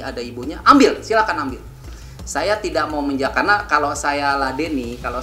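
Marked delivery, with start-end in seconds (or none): 0.6-1.6 retold angry quote. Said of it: "Pak Faisal terus bicaranya marah-marah ke saya".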